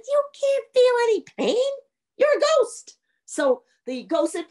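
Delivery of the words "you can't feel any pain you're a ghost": high, incredulous retort; exasperated rebuttal. Acted. Young man answers the complaining ghost, high exasperated retort. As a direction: throw it back in a high, raised voice.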